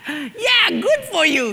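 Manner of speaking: high, sneering and pleased; smug gloating. Acted. Husband mocks his wife, high and smug. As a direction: rub it in her face.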